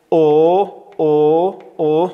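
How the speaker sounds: loud drawn-out "o" on "او"; damma vowel. Shown as a demonstration. Teacher drills damma, loud steady "o" on "او". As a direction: hold "او" as a rounded "o", not "oo".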